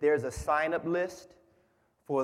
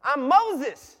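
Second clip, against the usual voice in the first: high, loud parrot squawk ending in a cry of "Rah!"; squawky parrot; 11 semitones higher, 8 dB louder, slower than usual. Acted. The parrot tells the burglar its name, squawking. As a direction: announce it like a proud talking bird. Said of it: "The bird said".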